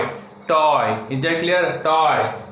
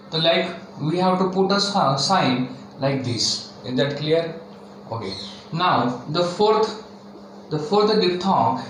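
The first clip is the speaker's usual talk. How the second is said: ordinary talk, nothing marked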